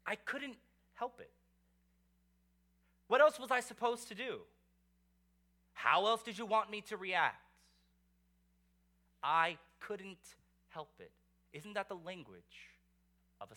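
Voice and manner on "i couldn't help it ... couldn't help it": mock excuse. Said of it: "what do they say?".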